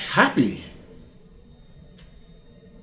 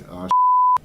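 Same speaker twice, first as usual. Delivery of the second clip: high, louder cry of shock, cut off; shocked outburst; 6 dB louder than usual. Retold or imitated. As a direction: blurt it out as the bad news lands. And I was like.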